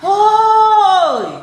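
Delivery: high, long-held hailing yell; loud shouting demo. Shown as a demonstration. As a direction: holler names across a distance. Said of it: "Orang yang berteriak dengan keras itu bagaimana?". (berteriak keras memanggil)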